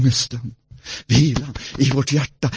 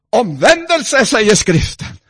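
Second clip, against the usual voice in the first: raised, louder proclaiming; preaching; 8 semitones higher, 7 dB louder than usual. Acted. Preacher calling the congregation to repent. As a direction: proclaim it from the pulpit.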